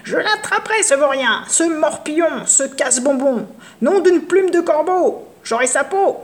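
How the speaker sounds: high, shrieking crone's rage; raging old witch shouting; furious witch. Acted. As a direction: shake your fist and swear revenge. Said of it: "Elle crie".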